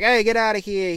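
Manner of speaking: loud, high call to bullies; a hammed-up loud heroic shout. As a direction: yell like a hero chasing off kids. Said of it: "it's like".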